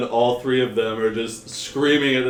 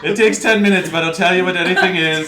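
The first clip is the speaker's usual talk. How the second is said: high, loud, excited figure showing off a machine; lab keeper, eager; 8 semitones higher, 9 dB louder than usual. Acted. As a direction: explain the gadget loudly with eager, high pitch. Figure explains the contraption.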